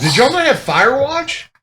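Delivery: high, loud disbelief; blurting it out in shock; surprised. Real, not acted.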